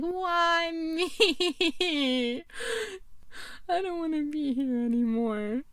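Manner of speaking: high, drawn-out fake wail; mock whining. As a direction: whine like a hammed-up crybaby, holding back laughter. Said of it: "I would just be straight up whining".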